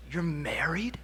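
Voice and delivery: hushed, stunned whisper; girl whispering in shock at the ring; whispered disbelief. Retold or imitated. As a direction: breathe it out in shock. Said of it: "She says".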